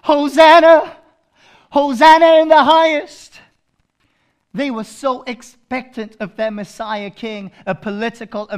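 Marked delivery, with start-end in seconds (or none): 0.0-3.4 high, very loud hosannas; crowd's praise cry. Acted. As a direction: shout it like the palm-waving crowd. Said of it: "They said".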